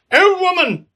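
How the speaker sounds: put-on high, loud call of old woman; mock Python shout; Dennis hailing. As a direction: bellow it up high like a silly knight. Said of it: "he says, you know, the first thing he pops out".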